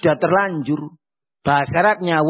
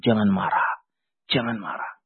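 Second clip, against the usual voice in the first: low and softer, fading; soft reminder; 10 semitones lower, 5 dB quieter than usual. Acted. A man reminds himself not to get angry, low and soft. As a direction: murmur it to yourself. Repeating the Prophet's advice softly.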